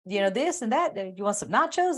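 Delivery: high, sing-song eager waiter offering food. Retold or imitated. Storyteller voicing the waiter listing beach snacks. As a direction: hype up the menu like a grinning waiter. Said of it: "And he goes".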